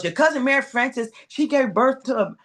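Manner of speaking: a hint of an excited father telling tales; dad's voice. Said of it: "And he goes".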